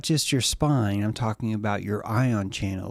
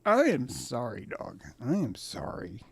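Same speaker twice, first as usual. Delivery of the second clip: apologetic owner; 7 dB quieter than usual. Acted. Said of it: "And you're like".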